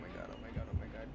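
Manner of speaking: terrified whisper, oh my god; breathless scared whisper; scared horror game streamer. Real, not acted. Hiding from the killer.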